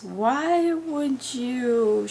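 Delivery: very slow, stretched-out bewilderment; perplexed. Acted. Mother puzzled by the voltage meter, perplexed. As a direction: draw each word out, trailing into disbelief. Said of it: "She looked perplexed".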